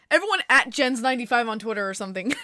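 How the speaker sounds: ordinary delivery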